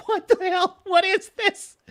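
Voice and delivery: mock-shocked what the hell; baffled outburst. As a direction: squawk it like you can't believe what you saw. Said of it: "And I'm like".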